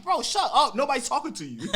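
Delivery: retold snap. Telling the meddling mother to shut up, loud. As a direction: shut her down hard, half laughing.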